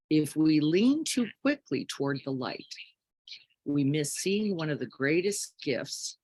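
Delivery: ordinary delivery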